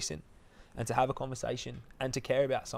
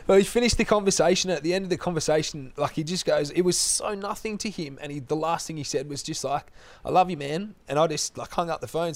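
getting emotional; 3 semitones higher, 9 dB louder than usual. Real, not acted. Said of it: "I'm gonna get emotional".

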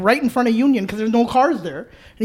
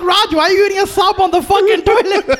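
incredulous yelling; 9 semitones higher, 3 dB louder than usual. Retold or imitated. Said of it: "I'm like".

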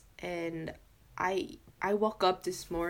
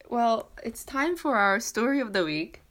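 bright, louder segment intro; excited announcement; 4 semitones higher, 6 dB louder than usual. Real, not acted. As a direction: announce it like a show host.

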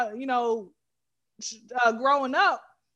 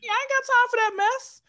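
indignant retort; 12 semitones higher, 3 dB louder than usual. Retold or imitated. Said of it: "I'm like".

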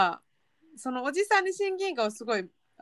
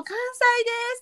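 eager; 13 semitones higher than usual. Pretend. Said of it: "って言いたくなる".

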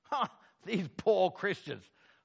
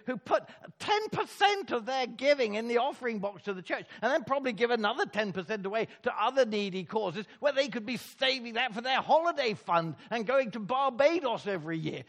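ordinary talk

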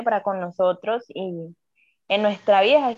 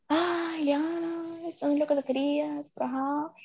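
higher, softer doubting inner voice; 6 semitones higher, 6 dB quieter than usual. Retold or imitated. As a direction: voice your own inner doubt, softly. Self-talk voicing inner doubt.

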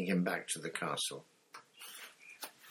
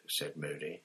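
normal talking voice

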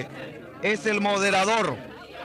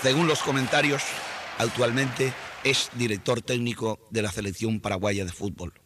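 put-on lower, Castilian-accented broadcaster voice; Spanish radio announcer; 10 semitones lower, 3 dB quieter than usual. Announcer in Madrid hands over to Paraguay, Castilian accent. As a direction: play a pompous Madrid announcer handing over the line.

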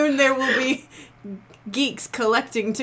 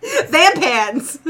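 gleeful; 4 semitones higher, 7 dB louder than usual. Real, not acted. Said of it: "Combo of vampire in Japan".

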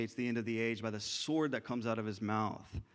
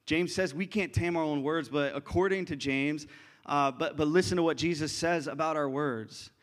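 ordinary talk, nothing marked

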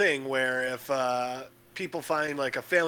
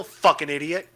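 ordinary talk

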